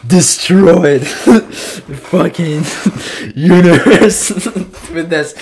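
high, loud words broken by laughs; laughing about how good the last track was; laughing in awe. Real, not acted. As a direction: laugh through it, blown away.

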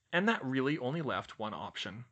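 plain reading voice

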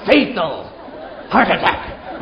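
a hurt wife laughing at her revenge wish; laughing vengeful wish. Acted. Preacher voices vengeful wife.

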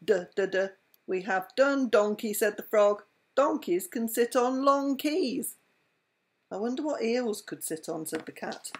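lively read-aloud with voiced animals; bedtime story reading. A narrator's reading.

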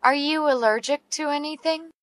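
ordinary talk, nothing marked

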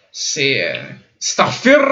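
ordinary talk

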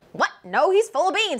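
ordinary talk, nothing marked

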